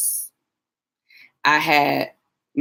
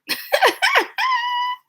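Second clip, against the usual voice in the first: a high, breathy little laugh; high giggle. Real, not acted. Vlogger laughing at her own comment, high giggle. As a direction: giggle at yourself.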